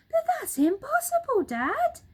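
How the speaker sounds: put-on very high, loud whiny child monkey; small monkey protesting. Small monkey telling his dad it's impossible, high whine.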